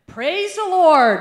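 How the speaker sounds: slow, high, very loud shout; relieved praise. Retold or imitated. Preacher saved at the cliff, crying out to heaven. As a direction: shout your thanks up to heaven.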